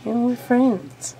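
loud, high pleading with a pet; loud playful pleading with a lizard; excited coaxing. Real, not acted. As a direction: coax the lizard, laughing it off. Coaxing lizard after it puffs.

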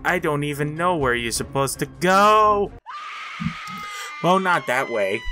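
ordinary talk, nothing marked

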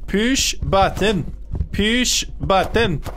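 slow, spaced-out words; deliberate, measured command; slow instruction. Real, not acted. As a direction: spell out PUSH BUTTON word by word. Instructing teammates, later escalating.

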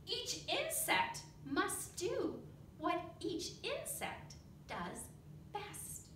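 lively read-aloud with big character voices; storybook reading. Acted. Teacher reading a bug story to the children, animated. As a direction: read it like a picture book for the class.

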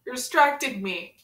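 ordinary talk, nothing marked

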